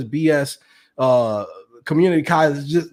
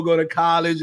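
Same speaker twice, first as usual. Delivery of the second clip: ordinary delivery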